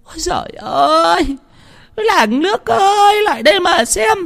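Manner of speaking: theatrical tantrum in a high keening voice; hysterical wailing. A narrator's reading. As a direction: wail to the whole village like a wronged mother. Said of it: "bà dãy lên đành đạch".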